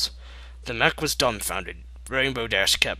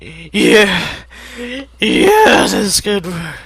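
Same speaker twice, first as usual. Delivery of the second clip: much higher, loud eager yelling; excited shout; 10 semitones higher, 11 dB louder, much slower than usual. Acted. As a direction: burst out with the idea. Excited realization.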